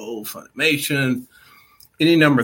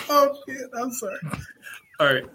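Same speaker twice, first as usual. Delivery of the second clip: giggling fit, high and breathless; losing it, can't stop laughing; trying not to laugh; 10 semitones higher than usual. Real, not acted. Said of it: "I'm losing it over here".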